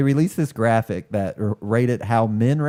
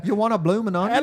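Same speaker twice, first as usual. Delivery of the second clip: high, hammed-up Aussie accent offering a bloomin' onion; mock Australian waiter voice, pitched well up; fake Australian accent; 11 semitones higher than usual. Joking about fake Aussies, mock Australian accent. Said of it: "complete with fake Australian accents".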